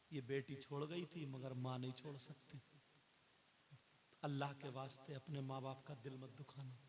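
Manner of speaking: normal talking voice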